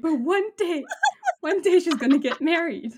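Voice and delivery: 边笑边模仿安妮的哀叹，音调高; 学安妮夸张地哭诉，忍不住笑; mock wail. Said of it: "she's like".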